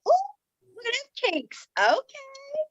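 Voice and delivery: high-pitched, drawn-out admiring coo; playfully ogle yourself, high and slow; self-admiring. Retold or imitated. Woman to her own mirror reflection, playfully self-admiring.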